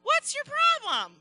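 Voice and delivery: high, loud, scolding little bug voice; bossy ladybug. Acted. Ladybug friend scolds the spider, high and loud. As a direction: tease her like a pushy best friend. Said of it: "her ladybug friend came over and she said".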